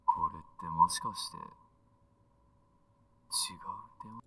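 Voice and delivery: teasing message through a phone filter; thin band-limited voicemail message; voicemail effect. Acted. Boyfriend leaving his kitten a message, voicemail sound. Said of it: "doesn't sound like it's on voicemail".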